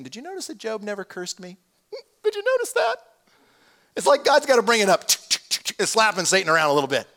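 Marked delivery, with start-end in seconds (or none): 0.0-3.0 much higher, mock-innocent question; teasing needling. God rubbing it in to Satan about Job, teasing. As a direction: needle him in a high, put-on voice.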